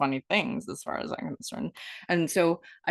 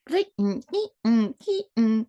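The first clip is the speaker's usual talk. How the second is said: dramatic gasp; 4 semitones higher, 4 dB louder than usual. Pretend. Mock channel awakening.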